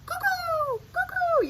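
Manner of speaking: high cuckoo-clock call. Acted. Reading the cuckoo's call to kids, high sing-song. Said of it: "The cuckoo called".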